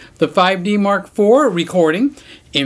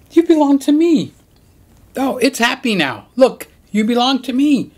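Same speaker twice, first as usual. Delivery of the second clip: pet talk; 8 semitones higher than usual. Real, not acted. Telling the pet it belongs to him, high doting coo.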